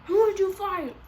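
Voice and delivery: high, loud mock interrogation; demanding mom. Mom arriving at school confronts daughter, mock outrage. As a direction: imitate a mother demanding an answer.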